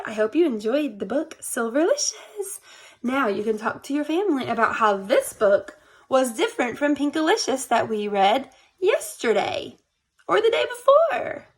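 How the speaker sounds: plain reading voice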